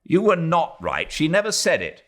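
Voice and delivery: a hint of an irritated son; young official contradicting her; lightly voiced young man. A narrator's reading. Ronnie answers his fiancée.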